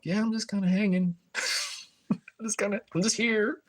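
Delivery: easygoing high voice, just hanging out; high breezy self-quote. Retold or imitated. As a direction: toss it off lightly, way up high. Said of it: "And I'm like".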